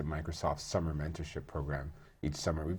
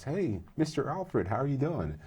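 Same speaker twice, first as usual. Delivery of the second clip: put-on young man greeting; 8 semitones higher than usual. Former student greeting him, retold.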